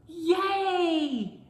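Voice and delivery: high, loud cheer; happy shout. A narrator's reading. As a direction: cheer it out with joy. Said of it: "shouted the little ghost".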